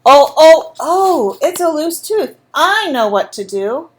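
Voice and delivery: a hint of a confident father; father's knowing oh. A narrator's reading. Father peering into Andrew's mouth, mild mock surprise. Father voiced examining tooth.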